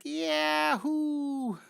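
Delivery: high, drawn-out gleeful whoop; delighted high-pitched cry; scream of delight. Acted. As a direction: whoop as a thrilled cartoon girl. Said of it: "screaming with delight".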